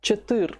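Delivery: Четыр cut off after the "r"; shortened form. Shown as a demonstration. Teacher shortens four for fourteen, Четыр ending on "r". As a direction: say Четыр without the final "ye". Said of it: "but you shorten it a bit".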